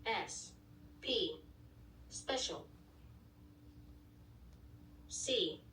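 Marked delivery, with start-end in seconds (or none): none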